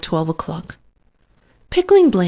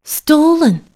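mouth full; 9 semitones higher, 7 dB louder than usual. A narrator's reading. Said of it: "with her mouth full".

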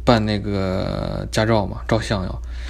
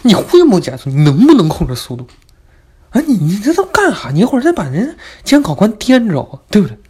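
angry scolding; 9 semitones higher, 7 dB louder than usual. Retold or imitated. Driving teacher scolds his speed.

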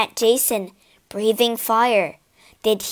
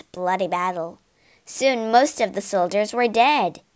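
ordinary delivery

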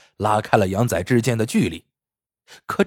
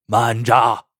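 a sudden commanding shout to halt; sharp shout; 3 dB louder than usual. A narrator's reading. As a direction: bark it out to stop him cold. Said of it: "突然听见有人吼道". (一声厉喝)

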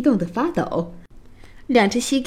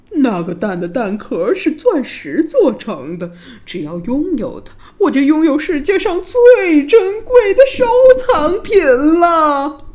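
king's boast building to a high shout; loud proclamation; 7 semitones higher, 7 dB louder than usual. A narrator's reading. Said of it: "大声叫道".

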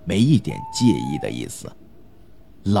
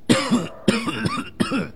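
acted old man's hacking cough; violent coughing fit. A narrator's reading. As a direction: cough hard, over and over. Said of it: "剧烈的咳嗽声". (剧烈咳嗽声)

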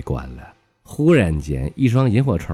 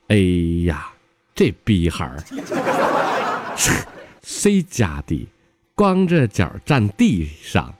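slow, drawn-out comic recitation; classmate's recited sentence; 3 semitones higher, slower than usual. Retold or imitated. Classmate reads out his made-up sentence to class, slowly reciting. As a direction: stretch out each phrase with mock gravity. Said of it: "他是这么造的".

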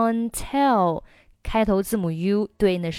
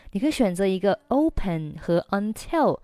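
ordinary talk

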